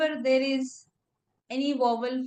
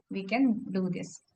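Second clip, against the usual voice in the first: ordinary delivery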